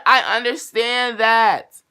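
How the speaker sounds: exasperated. Retold or imitated. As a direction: drag out every word in disbelief. Retold exasperated reply to clerk.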